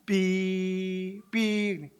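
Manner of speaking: long, steady monotone beep; imitated beep. Student hearing only a beep in class, imitated beep. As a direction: hold a thin electronic bleep. Imitates ringing when nothing is understood.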